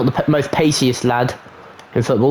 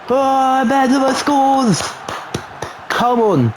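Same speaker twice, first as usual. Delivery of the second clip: high, drawn-out goal celebration; excited goal call; 10 semitones higher, slower than usual. Real, not acted. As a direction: commentator crying out as it goes in. Calling a goal in game commentary.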